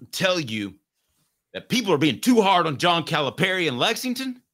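high-pitched mock-sermon plea; Rick Barnes preaching. Host imagines rival coach defending Calipari to the press, mock preaching. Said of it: "He could preach at you, couldn't he?".

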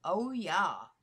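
"Oya" as oh-YAH, drawn out; "Oya" split into OH and YAH; oh-YAH pronunciation. Shown as a demonstration. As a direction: say "Oya" as oh, then a long YAH. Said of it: "Is it Oya or Oya?".

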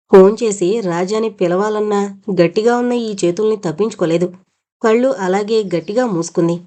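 usual speaking voice

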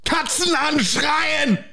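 high, drawn-out German shout; mock German screaming. Host shouting a quiz phrase, slow mock German scream. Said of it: "you're just screaming".

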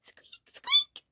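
extremely high, loud squeaking; piercingly high mock squeaks; mouse squeak. A narrator's reading. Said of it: "said mouse".

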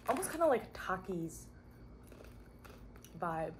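talking with mouth full; eating crunchy chips; chewing while talking. Real, not acted. Seen 1: Chip goes to mouth at 1.6–1.9, then chewing while she talks.

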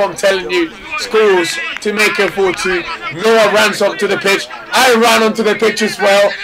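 normal talking voice

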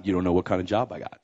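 low, quick, soft mimicry; mock complainer. Believer excusing himself over his job, glum.

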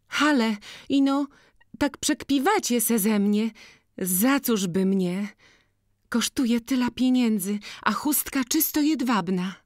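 high, giddy protest, partly whispered; can't believe it's for her, pitch it up; disbelieving girl. Acted. To Boryna over the silk kerchief, high and disbelieving.

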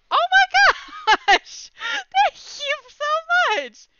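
high, drawn-out squealing thanks; real giddy, slow squeal; overjoyed. Real, not acted. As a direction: shriek with laughing delight, then ease slightly. Overwhelmed by viewers' gifts.

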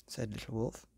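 ordinary delivery, nothing marked

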